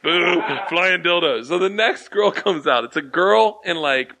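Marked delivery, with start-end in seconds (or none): 0.0-1.5 boo the stage like a sulky crowd; slow mocking boo. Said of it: "we're booing".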